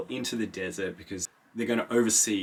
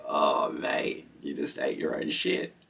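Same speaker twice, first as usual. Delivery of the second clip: mock deep voice; 7 semitones lower than usual. Vlogger mocking the sandworm.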